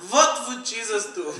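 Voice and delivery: loud, hammed-up Russian accent. Driver in Russia asking himself, Russian accent. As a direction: punch it out in a thick Russian accent. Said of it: "I wonder if in Russia".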